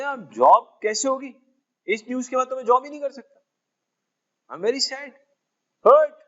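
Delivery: milk the sadness for laughs; hammed-up sorrow. Mock mourning, refusing to work.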